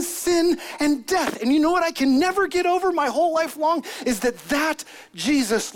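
very high, loud preaching at full force; fervent testimony. Acted. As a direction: proclaim it in awe, voice soaring. Acts John's fervent testimony in sermon.

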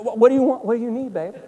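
catching himself, gentler with a laugh; softened correction. Retold or imitated. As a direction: switch to sweet dad mid-sentence. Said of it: "I said".

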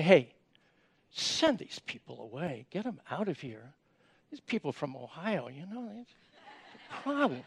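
low, hushed, conspiratorial plea; quiet grumbling disciple; hushed urging. Acted. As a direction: mutter it aside to Jesus like a secret complaint. Disciples urge Jesus, send crowd away.

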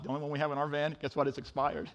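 ordinary talk

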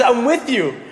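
high, excited sidekick pledge; Robin the sidekick. Acted. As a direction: declare loyalty like a keen superhero partner. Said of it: "Robin says".

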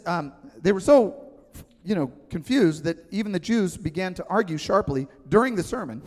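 ordinary talk, nothing marked

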